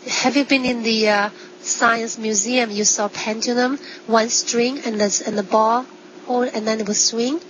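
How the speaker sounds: ordinary talk, nothing marked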